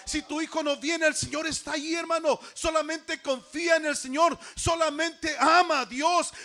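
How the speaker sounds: loud, high-pitched, impassioned exhortation; full-force preaching. Real, not acted. Preacher urging the congregation to trust God amid problems, full-force preaching. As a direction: preach it at the top of your voice, driving each phrase home.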